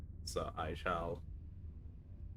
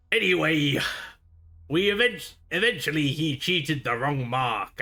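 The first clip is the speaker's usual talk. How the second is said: higher, louder swaggering pirate storyteller; relishing pirate tale; 3 semitones higher, 5 dB louder than usual. Acted. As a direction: savour the old captain's exploits. Pirate reminisces about Marlowe.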